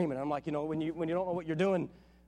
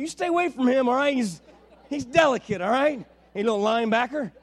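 mock protective warning; 3 semitones higher, 6 dB louder than usual. Warning the big baby off.

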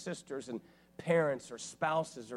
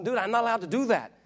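higher and louder, fed-up Dude; exasperated protest; 9 semitones higher, 8 dB louder, faster than usual. Retold or imitated. To the pastor demanding he preach, loud exasperated protest. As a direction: push back hard, exasperated.

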